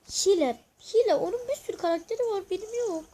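high-pitched complaint, voice up; indignant protest. Real, not acted. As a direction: whine that it's unfair.

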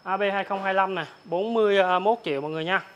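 normal talking voice

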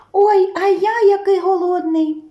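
дуже високий мишачий голосок; пропищи високо, жаліючись на голод; писклява скарга. A narrator's reading. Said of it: "пищить верть". (голодне мишеня пищить)

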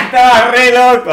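high, loud cackling laugh; loud laughter. Real, not acted. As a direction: laugh out loud, talking through it. Bursting laugh after plush voices. (carcajada fuerte)

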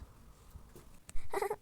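high breathy giggle. Pretend. Little sister giggles at big sister in a bathrobe. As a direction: burst into a giggle at her.

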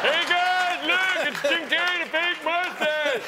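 high-pitched mock yell to pals; imitated gawker shouting across the seats. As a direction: call your friends over, high and stretched out. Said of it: "people were yelling out".

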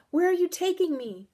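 high, loud, panicked question; alarmed cry; frightened demand. A narrator's reading. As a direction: demand an answer in terror. Terrified girl questions horse.

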